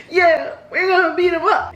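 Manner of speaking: high-pitched playful battle cry; mock hyped-up. Girl getting hyped with her sister, high mock cheer. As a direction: join the hype in a high voice.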